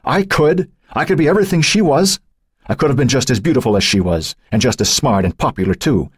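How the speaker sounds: a bitter, hardened claim. A narrator's reading. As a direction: dig in and refuse to yield. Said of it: "Joan's voice hardened".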